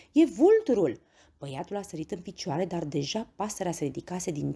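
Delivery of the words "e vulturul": high-pitched, loud exclamation; frightened cry. A narrator's reading. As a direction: shout an alarmed warning. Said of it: "a strigat speriată Heidi".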